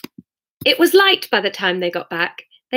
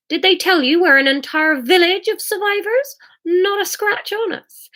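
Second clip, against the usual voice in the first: high-pitched, eager neighbour voice; proud elderly lady; 11 semitones higher than usual. Acted. Missus Shue tells the newcomer about the survivors, proud high voice. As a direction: boast in a high character voice.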